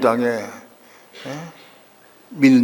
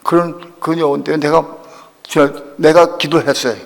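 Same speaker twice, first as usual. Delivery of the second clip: ordinary talk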